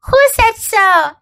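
Lucy challenging her brother; indignant girl. A narrator's reading. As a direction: demand it of him.

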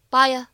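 "buy a" joined with a slight y glide; light vowel linking. Shown as a demonstration. Teacher shows learners natural linking, "buy a" as one word. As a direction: glide gently from "buy" into "a" without a break. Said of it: "自然又轻微的一个连读".

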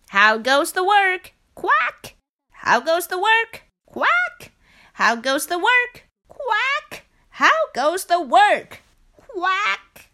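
busy back-and-forth of lazy farmer and weary duck. A narrator's reading. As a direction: rattle off the repeated question and quack. Farmer and duck exchange repeated.

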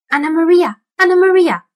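high, loud rat squeak. A narrator's reading. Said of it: "squeaked the rat".

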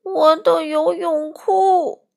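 high, loud, drawn-out yell. A narrator's reading. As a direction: wail it slowly in alarm. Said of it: "他大叫道". (拖长音高声大叫)

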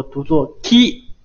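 ki said slowly and clearly; slow model of the syllable ki for students; 慢速示范ki的发音; teaching ki. Shown as a demonstration. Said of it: "注意这个发音".

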